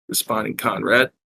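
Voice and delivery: ordinary delivery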